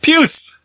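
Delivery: Puce said high and loud as pjuce, crisp; triumphant, very high Puce; proper pronunciation. Shown as a demonstration. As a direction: announce Puce with a sharp aspirated p and long u. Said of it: "Nailed it".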